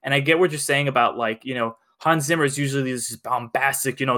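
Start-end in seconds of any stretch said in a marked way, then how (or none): none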